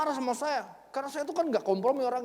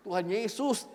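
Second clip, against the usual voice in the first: ordinary delivery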